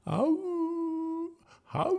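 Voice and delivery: long high "owoo" howl; dog howl. A narrator's reading. Dog joining in Nick's song, howling high. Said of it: "howled Whiffer".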